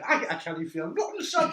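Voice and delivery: loud, high Geordie dad impression; hammed-up Geordie father, close to laughing; mock Geordie accent. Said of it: "well, Geordie".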